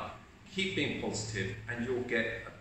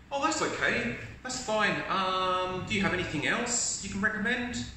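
much higher, easygoing acceptance; positive customer; 7 semitones higher than usual. Acted. Positive customer told there is no cappuccino, much higher and easygoing. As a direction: shrug off the bad news gladly. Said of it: "show you how ... in a positive way".

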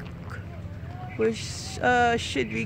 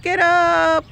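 coaxing call; 3 semitones higher, 7 dB louder than usual. Real, not acted. Urging the pig up.

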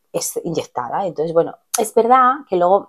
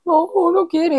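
put-on whiny child refusal; 11 semitones higher than usual. Scared little girl refusing.

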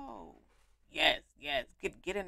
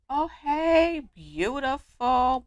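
loud, higher, slow gush; excited greeting; 6 semitones higher, 15 dB louder, slower than usual. Real, not acted. Gamer greeting a new friend, loud excited. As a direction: call out hey beautiful.

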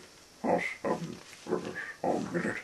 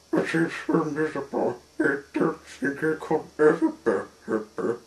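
high, loud, hurried lament; miserable character; 6 semitones higher, 7 dB louder than usual. A narrator's reading. Mister Miserable doubts he could change, high loud lament. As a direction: bemoan it in a high sorry voice.

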